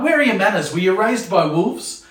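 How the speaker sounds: put-on a parent sarcastically scolding rude kids; sarcastic scold. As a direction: shame the kid with sarcasm. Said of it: "another sarcastic one".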